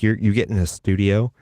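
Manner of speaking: usual speaking voice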